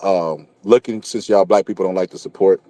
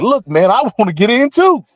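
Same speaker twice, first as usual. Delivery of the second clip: high-pitched comic complaint; pissed-off Hitler; 13 semitones higher than usual. Acted. Hitler waiting at heaven's gates, mock-indignant high whine. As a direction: protest from the back of the line, hammed up. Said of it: "Everybody just pissed off".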